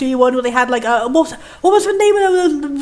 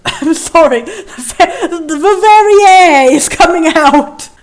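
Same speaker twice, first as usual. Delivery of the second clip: loud, higher, laughing outburst; cracking up; 3 semitones higher, 6 dB louder, slower than usual. Real, not acted. Apologising for a burp mid-read, loudly cracking up. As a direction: burst out laughing through the words.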